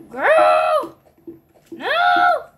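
high, loud panicked scream; being chased. Real, not acted. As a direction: scream as she closes in.

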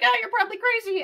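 high-pitched mocking doctor voice; lightly mimicking a doctor; mock dismissive doctor. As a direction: brush it off in a high voice.

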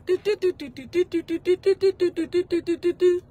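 rapid high keypad beeps; keypad tones. Pretend. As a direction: tap out beeps like dialing. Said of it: "when I do the phone calls".

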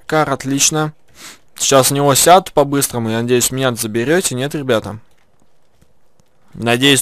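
ordinary talk

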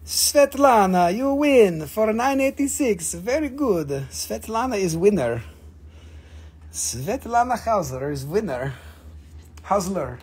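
put-on slow, playful Slavic accent. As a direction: do a teasing, drawn-out Slavic accent. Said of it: "accents are on point".

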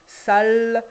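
"sale" with the s held loud; stretched word. Shown as a demonstration. Teacher recalling "sale" with a long s. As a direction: hold the s of "sale". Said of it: "consonnes longues".

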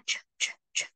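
Tr, tr, tr pushed out with a strong puff of air and no voice; aspirated tr. Shown as a demonstration. Teacher demonstrating aspirated tr to the class. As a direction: breathe out Tr, tr, tr as air only. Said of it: "Còn âm tr này là có hơi ra".

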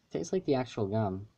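candy against the lips; slightly muffled lips; talking around a lollipop. Real, not acted. Seen 0: Ball at lips at 0.3 and 6.7-8.3; held away mid-stretch.

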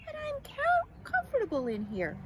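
lightly voiced little caterpillar calling out; Clara. A narrator's reading. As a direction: call back from inside, starting very high. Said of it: "called Clara".

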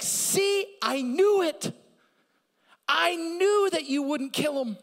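loud, high indignant outburst; mock angry Jonah. Preacher voicing Jonah's angry prayer, loud and high. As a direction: shout it like a sulking prophet.

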